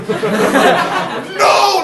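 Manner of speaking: loud, high mock cry; panicked yell. Teammate blocking the drunk friend's escape, panicked yell.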